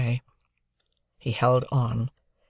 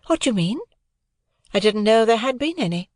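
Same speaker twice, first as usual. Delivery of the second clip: put-on higher, cool young lady's voice; a proud young woman answering lightly; Estella voice; 9 semitones higher than usual. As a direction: play Estella, poised and aloof.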